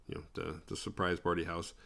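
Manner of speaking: ordinary talk, nothing marked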